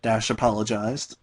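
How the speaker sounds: ordinary talk, nothing marked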